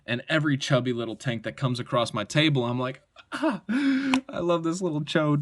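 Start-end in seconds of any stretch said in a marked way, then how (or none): 3.3-5.4 high, slow, put-on gushing voice; hammed-up lovestruck squeal, drawn out; mock adoring delight. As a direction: gush over it in a silly high voice. Acts out his delight at chubby knives.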